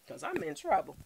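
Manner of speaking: ordinary talk, nothing marked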